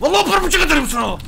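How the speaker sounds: high, loud, fast threat; furious threat. Real, not acted. Gamer threatens destructive mob, fast loud furious shout.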